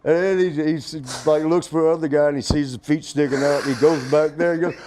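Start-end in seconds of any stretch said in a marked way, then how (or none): none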